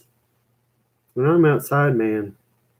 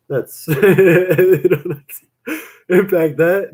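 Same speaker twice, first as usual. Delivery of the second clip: breaking into laughter, higher and louder; laughing; 7 semitones higher, 6 dB louder, much slower than usual. Real, not acted. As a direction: crack up mid-phrase at your own joke. Laughs at own bad joke.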